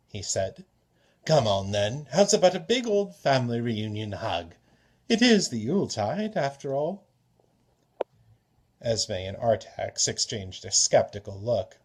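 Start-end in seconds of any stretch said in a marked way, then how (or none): 1.2-7.0 louder, hearty invitation; jovial trickster. Acted. Klepnos to the family, loud and jovial. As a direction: coax them into a hug.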